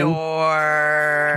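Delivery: slow, booming reveal; dramatic announcement. Acted. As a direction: draw the name out like doom.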